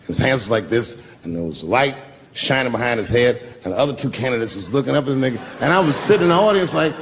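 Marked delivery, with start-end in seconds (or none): none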